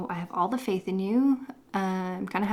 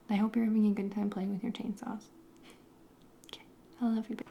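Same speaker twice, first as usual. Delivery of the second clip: whispered; 6 dB quieter, faster than usual. Real, not acted. Whispered love note to husband.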